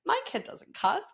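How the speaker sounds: high, hushed denial; retold stunned disbelief, small and soft; in shock. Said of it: "I was, like, in shock".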